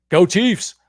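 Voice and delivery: high, loud rallying cheer; team cheer. Real, not acted. Host answering Catherine's Chiefs comment, excited cheer. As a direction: cheer it out like a fan.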